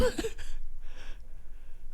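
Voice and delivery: breathy wordless laughter; real laugh. Real, not acted. Reacting to fast track to alcoholism, amused laugh. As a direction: laugh quietly at the joke. Laughing at a silly quiz answer.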